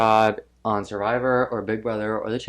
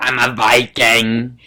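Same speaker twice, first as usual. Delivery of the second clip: Viking quote; 7 dB louder, slower than usual. Retold or imitated. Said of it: "she's like".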